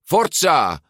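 loud, high cheer; calling out. A narrator's reading. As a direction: call out to spur a friend on. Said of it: "riep Giulia". (aanmoedigend geroepen)

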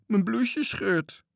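panicking about his shirt; frightened child. Acted. Toontje hanging in the air, frightened wail. Boy dangling by his collar.

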